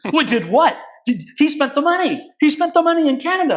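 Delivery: a judge blowing up in disbelief; outraged judge. Retold or imitated. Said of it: "judge's outraged reply".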